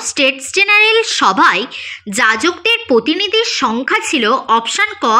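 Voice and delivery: ordinary delivery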